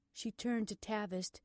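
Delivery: ordinary delivery, nothing marked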